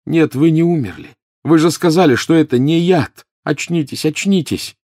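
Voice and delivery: desperate, urgent protest; panicked pleading. A narrator's reading. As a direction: plead frantically to rouse him. Harry shakes unconscious professor in panic.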